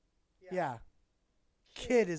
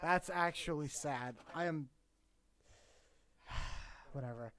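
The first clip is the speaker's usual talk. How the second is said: normal talking voice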